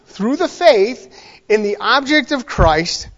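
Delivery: slow, punched verse reading; emphatic scripture reading. Acted. Preacher reading scripture to the congregation, slow and emphatic. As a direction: read the verse out deliberately, leaning on key words.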